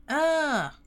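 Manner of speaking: sharp loud cry of alarm; sudden loud "ah"; startled yelp. Real, not acted. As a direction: yelp as if caught off guard. Startled in-game scare.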